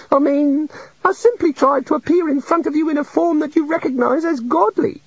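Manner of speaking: high meek little god. A narrator's reading. The little god confesses to the wizards, meek and high. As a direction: play a shy, sorry deity.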